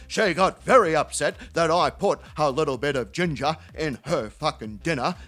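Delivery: low, emphatic put-on voice, slowing at the end; upset mate Terry. Terry confessing to his mate, low voice slowing to the punchline. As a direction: start forceful and low, then draw out the punchline. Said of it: "He goes".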